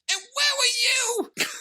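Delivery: very high, squeaky mock protest; hammed-up squeaking impression of an upset son; Jaden. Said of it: "Just let me do the impression".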